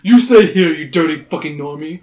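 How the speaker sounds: loud, scornful command; loud order. Acted. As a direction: bark the order. Carmine barks at Gabe.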